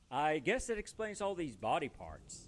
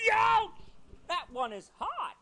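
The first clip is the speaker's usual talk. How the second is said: loud, high yelp; a hammed-up burnt-hand yelp; yelp of pain; 14 semitones higher, 5 dB louder than usual. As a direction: yank your hand back, shouting. Touches hot hand.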